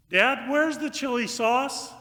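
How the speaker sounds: high, loud, slow call to Dad; child calling out. Acted. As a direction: holler like a kid from the kitchen.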